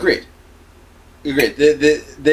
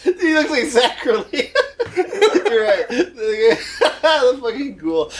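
high-pitched talk through real laughter; laughing; 10 semitones higher, slower than usual. Real, not acted. Co-host cracking up over the butler jibe, laughing through the words. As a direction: crack up while speaking.

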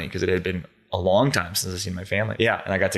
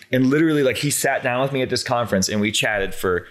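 normal talking voice

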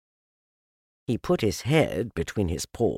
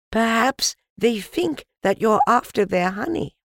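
put-on high-pitched child calling up; child's voice; 13 semitones higher, 4 dB louder than usual. A narrator's reading. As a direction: suggest it in a raised child's voice.

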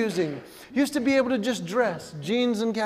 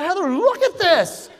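mock alarmed call; 8 semitones higher, 3 dB louder than usual. Showing wife ear hair.

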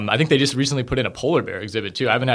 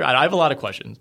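ordinary delivery

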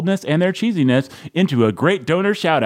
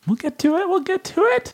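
very high mock-insistent squeak; squeaky high. Host promising the listeners, in a high squeak. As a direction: squeak it out reassuringly.

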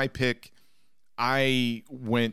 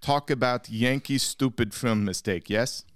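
broken English in a put-on Russian accent; mock Russian accent; 2 semitones higher than usual. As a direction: play a cartoon Russian host mocking Yankees.

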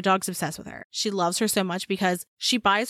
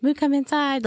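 calling the dog; 7 semitones higher, 4 dB louder than usual. Retold or imitated.

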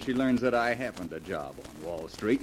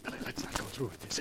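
quick, whispery plea to back out; hushed pleading; 3 semitones lower, 8 dB quieter, faster than usual. Acted. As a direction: whisper urgently to your accomplice. Plotters outside aunt's door.

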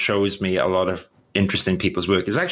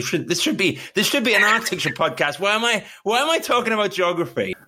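amused self-mockery; 10 semitones higher, faster than usual. Real, not acted. Laughs at own digression.

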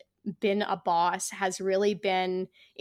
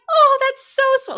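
high, loud retold gush; gushing delight; 11 dB louder than usual. Runner reacting aloud to the podcast, gushing delight. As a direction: squeal it out, delighted. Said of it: "I was like".

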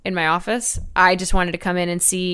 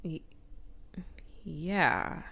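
whisper; 2 semitones lower, 15 dB quieter than usual. Real, not acted. Awkward whisper.

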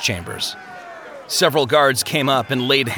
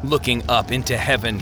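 ordinary delivery

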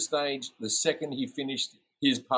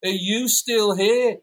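slow, loud, higher drawn-out insult; 8 semitones higher, 4 dB louder, much slower than usual. Retold or imitated. [name] yelling at the Maroon 5 guy in the toilet queue, mock yell. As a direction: drag out every word of the abuse. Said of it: "he goes".

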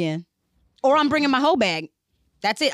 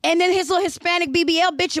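ordinary delivery

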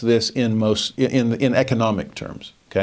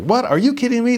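shrill leap up in disbelief, louder; incredulous disbelief; 13 semitones higher, 3 dB louder, faster than usual. Pretend. Crowd hearing Babylon has fallen, high incredulous outburst. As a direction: cry out like you can't believe the news. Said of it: "everyone's like".

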